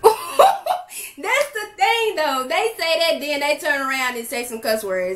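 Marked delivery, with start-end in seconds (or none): 0.0-2.5 laughing. Real, not acted. Laughing while reacting to clip.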